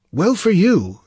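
high-voiced theatrical wizard; approving sorcerer. A narrator's reading. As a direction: say it high and knowingly. Sorcerer answers the king.

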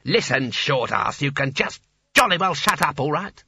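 irritated, emphatic telling-off; losing his temper. Acted. Sconner silences the small wizard, exasperated.

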